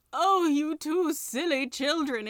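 high, loud, drawn-out wail; wailing tiger skin. A narrator's reading. Talking tiger skin scolds two children, wailing. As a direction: wail it slowly, pitched well up. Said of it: "it wailed".

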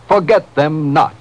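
usual speaking voice

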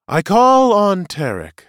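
high, loud, slow call; commanding summons. Acted. Seer calls the prisoner to testify, commanding. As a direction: summon the witness across a courtroom.

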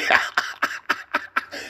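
airy unvoiced giggle; breathy laugh. Real, not acted. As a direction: let a soft breathless laugh out. Drunk vlogger cracks up.

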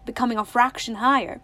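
ordinary delivery, nothing marked

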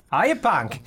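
put-on Australian accent that drifts Irish; Australian accent, half-hearted; attempted Australian accent. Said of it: "It almost sounds Irish now".

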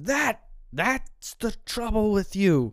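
high, loud, slow-drawn accusation; acted angry accusation. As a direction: play a heated film quarrel, stretching each word. Acting out the film's quarrel.

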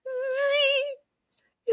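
high, soft, sustained buzz; imitated high mosquito drone; mosquito whine. A narrator's reading. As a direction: whine thinly in someone's ear. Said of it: "whining in people's ears".

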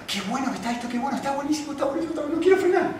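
carried away by the thrill, climbing in pitch; gleeful excitement. Retold or imitated. Retelling his joy riding the bike.

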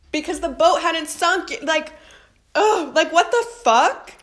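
loud, high-pitched disbelief; exasperated outburst. Real, not acted. Baffled outburst at her own dream.

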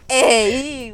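loud, drawn-out imitation of Osorno "ey"; exaggerated Osorno catchword, stretched. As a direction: punch out a playful Osorno-style "ey". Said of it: "La cultura osornina es el".

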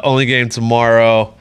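ordinary talk, nothing marked

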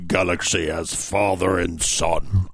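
Darth Vader impression. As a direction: intone it like a desperate Sith lord, slowly. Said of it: "he's like a more desperate Darth Vader".

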